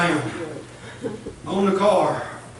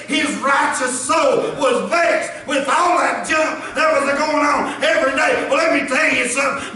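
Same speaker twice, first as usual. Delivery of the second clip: fervent preaching; 5 semitones higher than usual. Acted. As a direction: preach it with rising fire. Preaching at full force.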